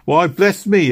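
high, loud startled cry; startled exclamation. A narrator's reading. Missus Blossom spots Doctor Christie, startled exclamation. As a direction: blurt it out in sudden surprise. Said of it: "exclaimed Missus Blossom".

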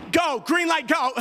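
loud, high urgent push. Retold or imitated. Husband urging wife to get up, loud. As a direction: shove her out the door, loud. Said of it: "we're like".

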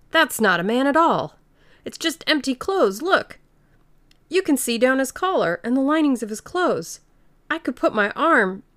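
high, loud amazed discovery; sudden excitement. A narrator's reading. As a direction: blurt it out in astonishment. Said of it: "said Huckster suddenly".

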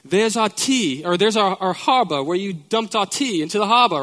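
a light mock British accent on "There's our tea"; lightly imitating an English mom's British tour-guide voice. English mom giving a Boston tour, in a light mock British accent. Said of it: "with that British slant".

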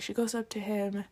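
usual speaking voice

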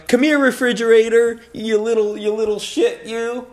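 loud, high doggy-talk voice; playful pet-calling. Acted. Owner calls goofily named dog over, playful high pet-calling. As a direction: call the dog with mock-scolding affection.

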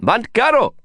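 loud, high yell at the driver; shouted. A narrator's reading. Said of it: "Prabhakar shouted".

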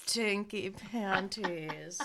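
very slow, savoring each word; drawn-out. Acted. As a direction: stretch both words out long and quiet. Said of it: "repeated slow".

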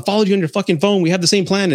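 fast, high retold yell; mock yelling husband. Husband yells back at his wife, fast and high. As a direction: snap it back like the angry husband. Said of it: "they're yelling at each other".

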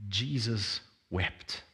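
Jesus wept, slow and hushed, very soft. Shown as a demonstration. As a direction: let the verse fall quietly and slowly. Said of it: "this most famous verse".

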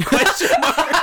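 high, loud laughter; cracking up. Real, not acted. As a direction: lose it laughing. Cracks up at sign.

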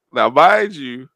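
ordinary talk

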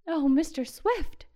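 loud, slow, high cry of joy; excited cry. A narrator's reading. Carol calls out to Mister Swift, loud and slow with joy. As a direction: let it burst out in delight. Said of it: "cried Carol".

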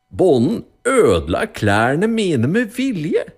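high, slow, indignant accusation; accusing rich man. A narrator's reading. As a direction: complain to the judge as the wronged rich man. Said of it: "sa den rike mannen".

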